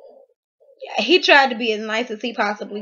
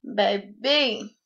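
ordinary talk, nothing marked

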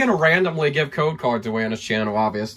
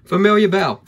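ordinary delivery

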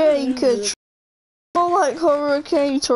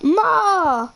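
high, whiny cry of an outraged son; Italian guy meme; 7 semitones higher, slower than usual. Retold or imitated. Imitating the Italian guy from the video, a high, whiny wail. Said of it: "Have you seen that video?".

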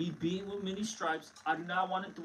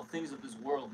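ordinary talk

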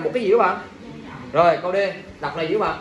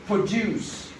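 Produce as pro-JUICE, second syllable long; second-syllable stress; 4 dB quieter than usual. Shown as a demonstration. Teacher drills the verb with class, second-syllable stress. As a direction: lean on DUCE in Produce. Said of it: "còn khi nào âm thứ hai?".

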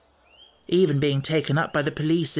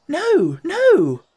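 much higher, louder desperate no; crying out; 8 dB louder than usual. A narrator's reading. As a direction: cry out in alarm. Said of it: "cried Anthea".

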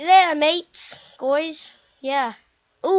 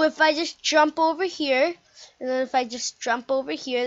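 ordinary talk

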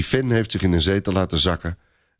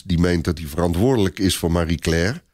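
ordinary delivery

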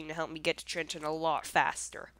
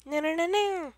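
panicked; 10 semitones higher, 4 dB louder than usual. Real, not acted. Panicking during a firefight.

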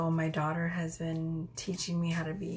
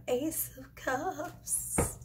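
high, drawn-out playful voice; silly put-on voice; 8 semitones higher, much slower than usual. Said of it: "why I did that voice".

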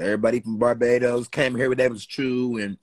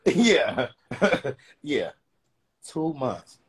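ordinary talk, nothing marked

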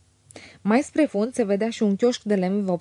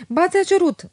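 much higher and louder, firm; firm, no arguing; 10 semitones higher, 5 dB louder than usual. A narrator's reading. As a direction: state it with a tone that allows no reply. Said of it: "cu o intonație care n-admite replică". (Otilia insistă ferm, fără drept de replică)

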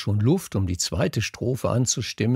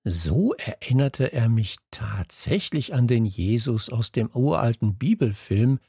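ordinary delivery, nothing marked

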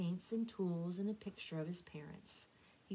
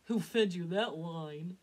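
chuckling; 6 dB louder, slower than usual. A narrator's reading. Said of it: "Drake chuckled".

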